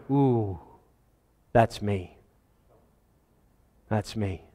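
low, very quiet murmur to himself; hushed realization. Acted. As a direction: let it sink in softly, pitch dropped. Preacher acts John's awed realization.

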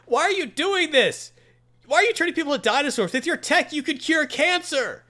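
high, loud, incredulous hero plea; pleading hero. Retold or imitated. Spider-Man pleading with villain.

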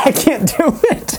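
high, drawn-out laughing protest; helpless laugh, pitched high. Real, not acted. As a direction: give up on it through laughter. Laughing at own failed accent.

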